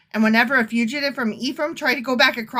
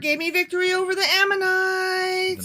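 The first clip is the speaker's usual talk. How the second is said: exasperated; 8 semitones higher than usual. Acted. Jephthah boasting of victory over the Ammonites, exasperated. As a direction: stretch it out high, hammed-up and fed up. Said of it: "he's sounding so exasperated".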